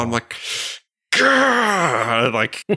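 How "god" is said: a high, anguished cry; frustrated groan. Retold or imitated. Realizing the AirPods were left at the theater, pained cry. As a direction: cry it out in dismay.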